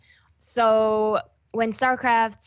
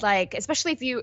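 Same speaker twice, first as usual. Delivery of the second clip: ordinary delivery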